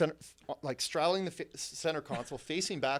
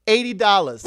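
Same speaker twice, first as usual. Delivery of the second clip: loud, higher mock prostitute voice; imitated streetwalker, loud and high; quoted price; 5 semitones higher, 11 dB louder than usual. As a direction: call out the price, pitched up. Voices prostitute quoting her price.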